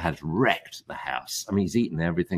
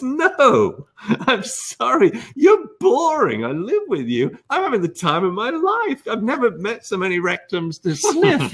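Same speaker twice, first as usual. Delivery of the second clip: cheeky dog voice; 12 semitones higher than usual. Retold or imitated. Dog brushing off its boring owner, in a high playful voice. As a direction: voice the dog cheerfully refusing. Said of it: "And the dog says".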